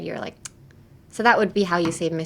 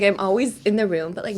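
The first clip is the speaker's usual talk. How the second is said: usual speaking voice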